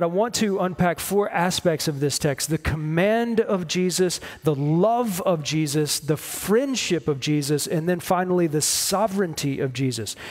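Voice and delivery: ordinary delivery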